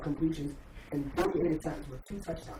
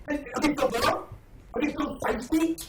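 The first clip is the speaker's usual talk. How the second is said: higher and louder, disbelieving questions; incredulous; 10 semitones higher, 5 dB louder, slower than usual. Real, not acted. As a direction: scoff at his example. Incredulous rebuttal in debate.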